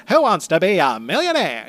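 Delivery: high transatlantic game show host voice; old Hollywood host, grand and high; glamorous old hollywood star. Retold or imitated. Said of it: "he talks a little bit like this".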